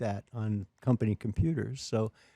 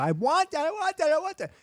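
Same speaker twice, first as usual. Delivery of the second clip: high mock tantrum wail; toddler tantrum; 14 semitones higher than usual. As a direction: imitate a demanding two-year-old, much higher. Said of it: "kicking and screaming".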